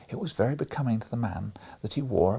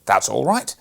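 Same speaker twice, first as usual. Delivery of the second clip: put-on dismissive boy; 3 semitones higher, 9 dB louder than usual. A narrator's reading. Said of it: "said Fred".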